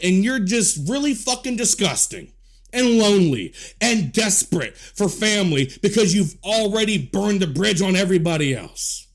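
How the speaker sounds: ordinary talk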